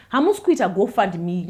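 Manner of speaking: normal talking voice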